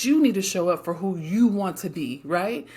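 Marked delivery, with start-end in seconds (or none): none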